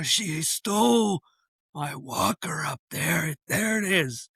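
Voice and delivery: high, strained mock plea, slow start; lightly acted breathless complaint; imitated labored breathing. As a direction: imitate a struggling, winded man. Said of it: "very breathy, labored breathing".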